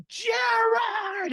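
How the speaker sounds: high, drawn-out mock shout in a big accent; hammed-up delighted holler of a name; big accented greeting. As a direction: call the name out like greeting an old friend, imitating his accent. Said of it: "in his big accent".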